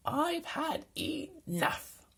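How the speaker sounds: high, whispered, slow despair; exhausted boy giving up on the mountain; defeated cry. A narrator's reading. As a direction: give up with a whispered wail. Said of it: "he cried".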